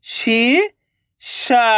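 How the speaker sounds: शीशा drawn out very slowly, each sound held. Shown as a demonstration. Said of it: "तो मिलके बन जाएगा". (शीशा को बहुत धीरे खींचकर बोलें)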